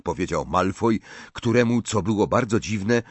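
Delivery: ordinary delivery, nothing marked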